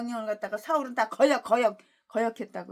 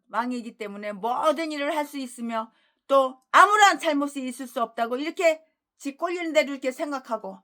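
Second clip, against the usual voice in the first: normal talking voice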